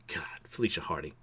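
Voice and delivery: softly whispered aside; whispered admiring aside. Real, not acted. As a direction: breathe it out under your breath. Admiring Mary Jane in costume.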